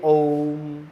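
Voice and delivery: "ओम" with the ओ held three times long; prolonged Om. Shown as a demonstration. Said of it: "इसको तीन गुना बोला जाए".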